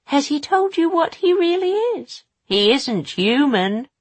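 high, loud sneering crone; taunting witch voice. Acted. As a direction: needle her with a sly taunt. Mrs Dark taunts Tessa.

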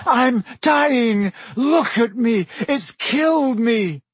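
high, loud, hysterical wailing; panicked yell. A narrator's reading. Malfoy blaming the beast for his wound, yelling. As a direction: wail it out high, stretching the end. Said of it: "Malfoy yelled as the class panicked".

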